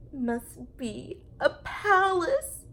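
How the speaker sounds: high, slow, breathless awe; gasped wonder. Acted. Teddy bear gasping at the grand furniture. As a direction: gasp it out in wonder. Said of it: "Corduroy gasped".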